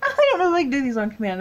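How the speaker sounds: a real burst of laughter at her own attempt; laughing at herself. Real, not acted. Laughs at failing the voice.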